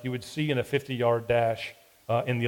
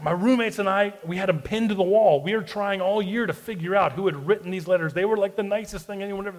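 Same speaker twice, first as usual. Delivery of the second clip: retold gushing; 6 semitones higher than usual. Friend gushing about the letters.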